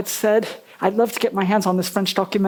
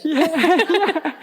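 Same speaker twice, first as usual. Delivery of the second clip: laughing; 8 semitones higher than usual. Real, not acted. Laughs at interviewer's joke.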